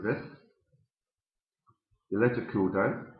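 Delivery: talking around pipe stem; pipe in mouth. Real, not acted. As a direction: teeth clenched on pipe. Seen 2: Pipe stem clenched in his mouth throughout while talking and cleaning.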